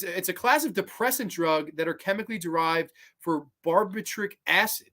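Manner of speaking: ordinary talk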